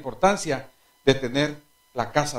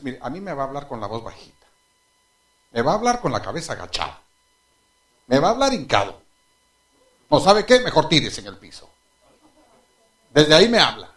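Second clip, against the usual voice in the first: ordinary talk, nothing marked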